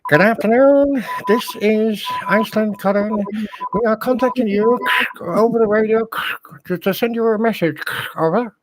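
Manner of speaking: high, loud formal radio call; radio message voice. Pretend. Calling the group over the radio in a mock official voice. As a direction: read it like an official radio transmission. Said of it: "Messages coming in".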